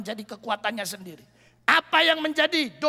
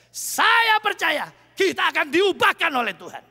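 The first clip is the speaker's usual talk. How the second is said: full-force preaching; 6 dB louder than usual. Acted. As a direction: drive the promise home to the church. Preacher driving home the close.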